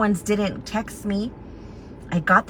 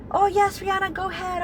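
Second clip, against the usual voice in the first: high mock reply; 10 semitones higher, 3 dB quieter than usual. Owner's reply acted out.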